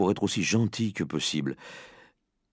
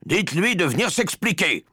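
high, raised, impatient command; sharply pitched-up demand; irritated order; 11 semitones higher, 4 dB louder than usual. Acted. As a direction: order it as an annoyed father. Father orders son be fetched.

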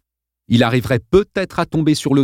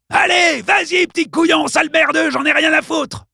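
much higher, furious yelling; bully rages at the boy; raging. A narrator's reading. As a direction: froth with rage. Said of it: "Congdon écumait de rage".

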